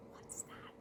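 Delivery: very soft whisper; hushed wondering. Acted. Cat hearing a strange noise, whispering. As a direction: whisper it warily to yourself.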